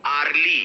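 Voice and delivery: "early" mispronounced as ar-lee; wrong vowel. Shown as a demonstration. Teacher imitates students' mistake, wrong "arly". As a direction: open the first vowel of "early" to ar. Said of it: "आप लोग क्या बोलते हो".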